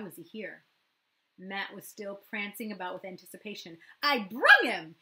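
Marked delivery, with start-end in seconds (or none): 4.0-5.0 put-on loud, high, gleeful little boy; a proud child showing off his find; gleeful announcement. A narrator's reading. Matt announcing to Kira, loud gleeful child voice. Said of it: "he announced gleefully".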